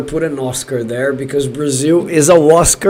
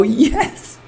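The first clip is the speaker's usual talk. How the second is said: high, softer squeal of triumph; gleeful cheer; 7 semitones higher, 6 dB quieter than usual. Real, not acted. Fan calling Brazil Oscar winners, gleeful. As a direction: squeeze out a delighted yes.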